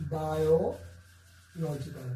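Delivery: बायोलॉजिकल drawn out very slowly, each syllable clear; slow pronunciation demo. Shown as a demonstration. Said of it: "teacher models the word".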